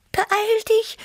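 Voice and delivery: very high, excited little cat; Tibi. A narrator's reading. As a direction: squeak it urgently as an excited cat. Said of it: "rief Tibi aufgeregt". (aufgeregt, ganz hohe Stimme)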